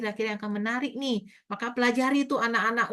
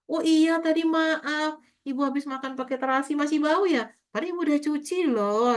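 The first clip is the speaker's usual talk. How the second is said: put-on teacher apologizing to child; 6 semitones higher than usual. Teacher apologizes to child about hand smell, gentle higher voice. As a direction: apologize sweetly to the little one. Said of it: "Kita sampaikan komunikasi ke anak-anak kita".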